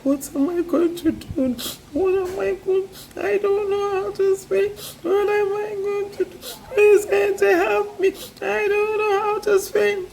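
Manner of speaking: put-on high, tearful wail of a frightened girl; high tearful girl voice, pleading; crying girl. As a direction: whimper like a trapped girl close to tears. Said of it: "She began to cry".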